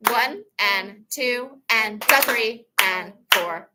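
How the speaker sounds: slow, deliberate clap-count; teacher leading a slow count; slow counting demo. Shown as a demonstration. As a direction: count each beat slowly and clearly. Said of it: "And go".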